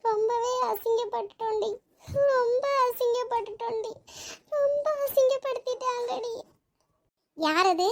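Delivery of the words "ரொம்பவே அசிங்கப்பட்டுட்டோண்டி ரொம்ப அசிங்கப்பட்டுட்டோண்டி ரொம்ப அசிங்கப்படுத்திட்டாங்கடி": high, tearful wail of humiliation; weeping confession, voice raised in pitch; crying. Acted. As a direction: sob it out to a friend, pitched high. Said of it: "எதுக்கு இப்படி அழுதுகிட்டு இருக்க".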